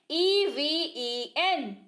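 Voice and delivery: letters spelled slowly one by one; slow spelling. Shown as a demonstration. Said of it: "इवन".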